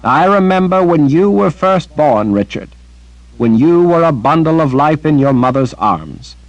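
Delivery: put-on elderly sorceress, affectionate; tender Anne. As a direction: speak like a proud old aunt. Anne fondly recalls his birth.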